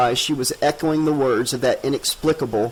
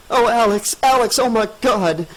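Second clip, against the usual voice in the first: high, loud breathless relief; panting panic; 9 semitones higher, 4 dB louder than usual. A narrator's reading. Tricia waking from the nightmare to Alex, panting and frightened. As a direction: gasp it out still shaken. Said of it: "Tricia panted".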